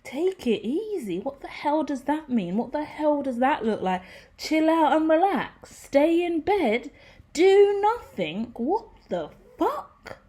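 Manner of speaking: spluttering like the idea makes no sense; incredulous outrage. Retold or imitated. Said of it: "I'm like".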